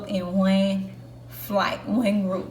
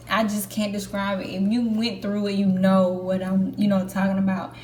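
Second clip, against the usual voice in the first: ordinary talk, nothing marked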